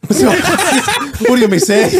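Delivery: high, laughing movie line; laughing quote. Retold or imitated. To friends, finishing the quote in laughter. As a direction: squeeze it out laughing.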